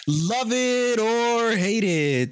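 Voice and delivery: slow, drawn-out game show title; enthusiastic announcer. Acted. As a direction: announce it like a TV host. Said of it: "You gotta say it with more enthusiasm".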